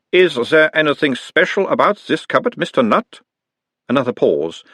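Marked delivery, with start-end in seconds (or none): 0.1-3.2 put-on higher, louder doctor's voice with a light Uberwald accent; mild Uberwald accent. A narrator's reading. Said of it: "talking like someone from Uberwald".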